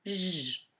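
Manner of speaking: « J » chanté en son doux « jjj », tenu. Shown as a demonstration. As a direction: fais durer le son « jjj » de « J ». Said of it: "vont la faire chanter J". (« J » prononcé « jjj », long et continu)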